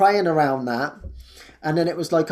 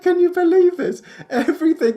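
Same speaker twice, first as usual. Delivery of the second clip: much higher, laughing disbelief; amazed; 13 semitones higher, 4 dB louder than usual. Real, not acted. Marvelling at being taken care of, laughing. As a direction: marvel at your luck through laughter.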